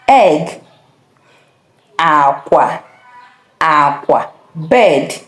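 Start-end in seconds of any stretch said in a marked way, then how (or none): 2.0-4.3 "Aqua" low on both syllables; low-low tones on "Aqua"; bed tone. Shown as a demonstration. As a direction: keep "Aqua" low, with no lift at the end. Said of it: "you have to listen attentively".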